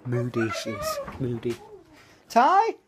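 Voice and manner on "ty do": high, fast sing-song talk to the dog; chirpy pet voice, much higher. Real, not acted. Cooing at the dog.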